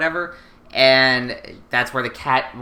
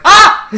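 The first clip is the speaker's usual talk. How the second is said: high, loud startled shriek; mock shriek; 14 dB louder than usual. Lelouch spotting the cat, loud high shriek. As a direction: yelp like you just saw a ghost. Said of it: "He's like".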